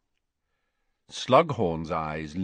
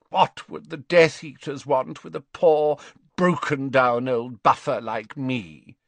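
put-on loud, high indignant old man; demanding; 6 semitones higher, 5 dB louder than usual. A narrator's reading. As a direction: demand it, offended. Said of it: "demanded Slughorn".

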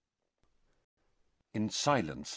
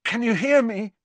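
high, tense, louder question; strained worry, pitched high; 11 semitones higher, 5 dB louder than usual. A narrator's reading. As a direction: ask in a strained, raised voice. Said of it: "his voice strained".

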